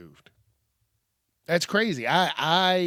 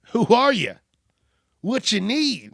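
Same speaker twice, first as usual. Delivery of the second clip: loud, high acted demand; wary challenge at the door; 7 semitones higher, 7 dB louder than usual. Man tucked in bed calls to whoever knocked, acted loud challenge. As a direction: call out sharply higher to whoever knocked. Answering imagined late-night knock.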